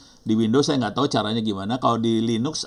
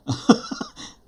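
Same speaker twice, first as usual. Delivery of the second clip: short high-pitched chuckle; laugh. Real, not acted. Teacher amused by the server error, laughing. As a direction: burst into a brief, high giggle. Jokes about overloaded MIT server.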